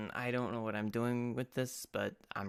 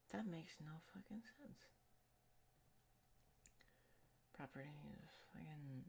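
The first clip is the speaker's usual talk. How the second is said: whispered curse under the breath; frustrated whisper; 4 semitones higher, 25 dB quieter, faster than usual. Real, not acted. Streamer whispering to himself when game audio fails. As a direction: hiss it to yourself, annoyed.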